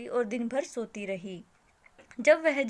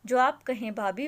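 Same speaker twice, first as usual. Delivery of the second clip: ordinary delivery, nothing marked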